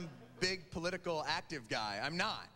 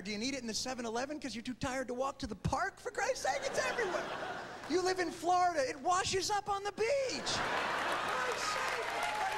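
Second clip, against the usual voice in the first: exasperated rant; 13 semitones higher than usual. Real, not acted. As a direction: rant at the absurdity, rising. Exasperated rant about legal pot.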